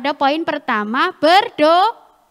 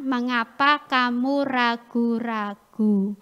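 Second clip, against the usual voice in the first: slow, measured recital; memory verse recitation; 4 semitones lower, slower than usual. Acted. Preacher recites the memory verse with children, slow recitation. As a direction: pace each phrase for a group to follow.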